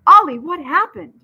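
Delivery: put-on high, loud, anxious girl voice; urgent question. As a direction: blurt it out in worry. Coco anxiously asks Ollie.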